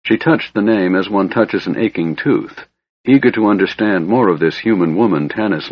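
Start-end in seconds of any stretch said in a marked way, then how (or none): none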